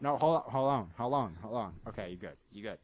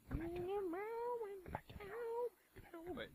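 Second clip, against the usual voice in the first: high calling voice; 12 dB quieter than usual. Real, not acted. Calling after a floating player.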